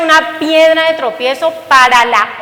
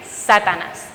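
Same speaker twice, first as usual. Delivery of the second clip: low stern rebuke; 6 semitones lower, 5 dB quieter, slower than usual. Retold or imitated. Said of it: "el Señor va a decir".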